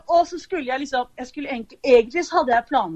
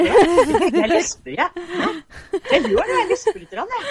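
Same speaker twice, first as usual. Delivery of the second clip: laughing; 8 semitones higher, 3 dB louder than usual. Real, not acted. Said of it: "hørte jeg plutselig at jeg lespa".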